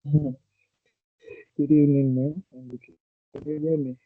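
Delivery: muffled, as if hand over mouth. Real, not acted. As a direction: speak through a covered mouth. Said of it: "it's like you put your hand on your mouth".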